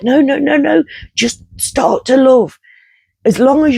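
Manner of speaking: calm them, but urgently; urgent reassurance. Retold or imitated. Urging the screaming crowd in the dream to just love, insistent and high.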